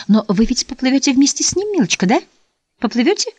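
high, rising pleading question; anxious question. A narrator's reading. Mother asks if she will swim with him, anxious.

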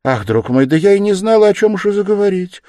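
high, loud, flustered outburst; flustered mother's high exclamation; blurted out. A narrator's reading. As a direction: burst out helplessly, voice climbing. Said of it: "вырвалось у Пульхерии Александры".

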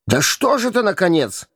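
exasperated. A narrator's reading. Hugo loses patience with the stubborn prince, exasperated. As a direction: throw up your hands in annoyance. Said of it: "проговорил в сердцах Гуга".

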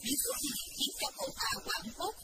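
usual speaking voice

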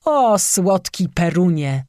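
slow, anguished groan to the heavens; groaning lament. A narrator's reading. Husband groans to Perun in despair, slow and anguished. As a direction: moan it out in despair. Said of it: "jęknął".

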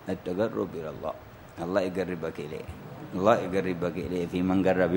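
low, soft murmured blessing; hushed prayer. Real, not acted. Teacher praying that God draws the student near, hushed and low. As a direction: drop right down into a quiet, intimate supplication.

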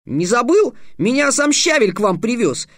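put-on high indignant boy flaring up; a boy bristling at the insult, voice up high. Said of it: "вскинулся Халанбери".

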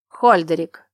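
sharp, loud summoning shout; whip-crack shout. Acted. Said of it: "Голос герцога стеганул воздух плетью". (резкий окрик, как удар плетью)

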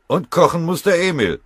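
high, loud, lively call; piping up. Acted. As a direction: pipe up keenly over the group. Soldier pipes up in group.

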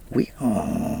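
lightly brayed oui like a donkey; a hint of a donkey's hee-haw. A narrator's reading. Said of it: "plus fort que l'âne encore".

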